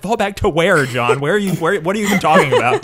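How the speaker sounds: high, exasperated mock questioning; baffled protest. Viewer talking back to Jon on screen, baffled.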